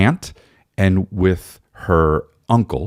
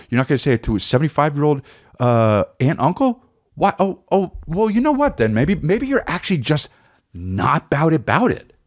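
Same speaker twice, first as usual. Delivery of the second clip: much higher, disbelieving taunt; mocking self-challenge; 8 semitones higher than usual. Comic doubting his own nerve, mocking and high-pitched. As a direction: dare yourself, pitched right up.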